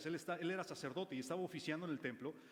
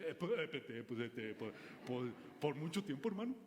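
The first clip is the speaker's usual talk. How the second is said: mock fumbling, hemming and hawing; hesitant men. Men in the congregation fumbling an answer to the preacher, mock hesitant. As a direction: stall like a man unsure of the answer.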